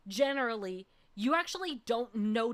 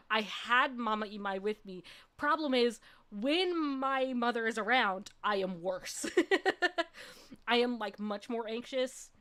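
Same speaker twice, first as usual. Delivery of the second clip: usual speaking voice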